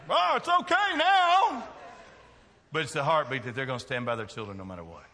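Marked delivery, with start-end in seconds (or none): 0.1-1.7 very high, loud, drawn-out mock reassurance; indulgent mama. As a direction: coo it loudly like a hammed-up doting mother. Acting a forgiving mama's reassurance.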